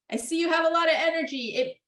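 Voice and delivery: put-on high, quick, kind narration to an angry child; name the feeling, offer the paper; acknowledging parent. Shown as a demonstration. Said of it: "always narrate and always acknowledge".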